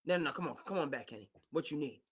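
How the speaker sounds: quick, hushed coaxing; coaxing owner. Retold or imitated. Owner pulling Kenny Atkinson back from Charlotte, quick hushed coaxing. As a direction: reel him back in softly.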